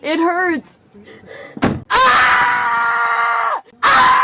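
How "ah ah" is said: loud anguished scream; pained scream. Real, not acted. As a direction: scream out in real pain. In pain in the car.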